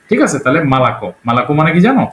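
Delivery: usual speaking voice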